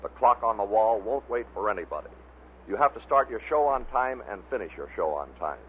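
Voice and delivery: ordinary delivery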